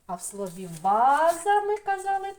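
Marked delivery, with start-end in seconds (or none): 0.8-1.6 ваза with a long, loud first а; stress on first syllable. Shown as a demonstration. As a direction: lean hard on VA in ваза. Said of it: "теж буде наголос". (ваза з голосним наголосом на перше а)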